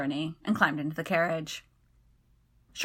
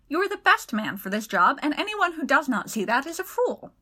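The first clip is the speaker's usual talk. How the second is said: indignant; 7 semitones higher than usual. A narrator's reading. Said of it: "Segundus exclaimed".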